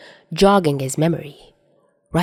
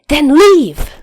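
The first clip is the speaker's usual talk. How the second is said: much higher, loud yell; furious shout; 10 semitones higher, 7 dB louder than usual. Acted. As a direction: scream him out the door. Chuya shouting Dazai out.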